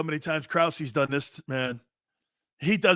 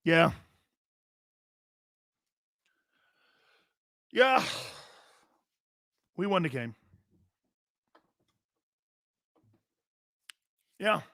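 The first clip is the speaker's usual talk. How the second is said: lightly voiced terse athlete after a win; short answers with long pauses, as an impression; Baker Mayfield. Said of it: "let me do my impression of Baker Mayfield".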